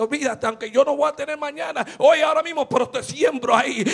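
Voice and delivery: loud, rousing sermon delivery; full-force preaching. Acted. Preacher urging the church to sow seeds of love, loud fervent preaching. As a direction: preach it hard, pushing every phrase.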